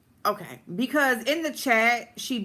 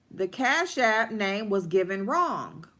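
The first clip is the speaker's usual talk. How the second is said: ordinary delivery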